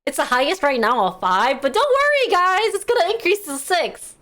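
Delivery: high, sing-song mock reassurance; mocking quote. Streamer mocking lenders to chat, high sing-song. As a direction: parody the lenders' cheerful reassurance. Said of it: "they were like".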